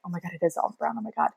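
whispered oh my God; starstruck whisper. Retold or imitated. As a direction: mouth it like you can't believe your eyes. Starstruck on seeing him.

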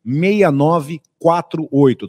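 slow, paused, each digit spelled out; speaking slowly. Shown as a demonstration. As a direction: read the number digit by digit, slowly. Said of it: "Tô falando pausadamente pra você reservar".

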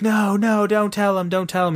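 high-pitched mock plea, easing off slightly; imitated pleading woman. Voicing hostage woman's plea.

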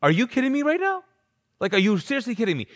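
can't believe what he's hearing; Jesus reacting to Martha, high and incredulous. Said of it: "if Jesus was like".

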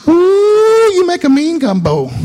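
high, loud grandma coo; a "Ooh" that jumps way up, then praise; grandma cooing praise over the gumbo pot; imitating grandma. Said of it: "And she'd say".

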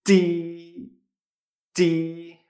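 "ti" as Mandarin "ji" through a smile; Chinese J, smiling. Shown as a demonstration. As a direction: say "ti" as a long, level, unaspirated "ji". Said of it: "Cuando sonríes".

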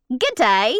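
hammy Australian "good day"; a proud, amateur Aussie greeting; attempted Australian accent. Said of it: "nailed that accent".

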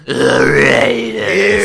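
very slow, drawn-out loud call; weird announcer voice. Retold or imitated. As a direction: stretch it like a sportscaster. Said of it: "that weird shit you do whenever we talk about the Vegas team".

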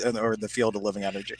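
ordinary talk, nothing marked

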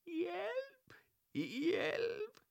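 very high, tiny muffled cry for help; small voice from a box. A narrator's reading. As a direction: squeak for help from inside. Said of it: "en svag stemme inden fra kassen".

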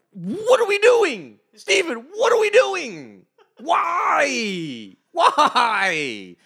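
very high, loud wailing cry; hammed-up despairing cry; mock anguish. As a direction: wail at Steven like it hurts. Anguished outburst at the comic.

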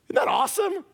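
excited shout. Real, not acted. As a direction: shout your delight. Excited exclamation.

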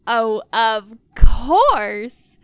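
loud, drawn-out eager assent; eager welcome. Acted. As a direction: welcome them in with big, slow emphasis. Welcomes partner in beside her.

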